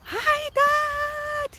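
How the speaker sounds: high, bright mock child shout of hi dad; delighted child waving to his father; excited kid call. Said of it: "he's just like".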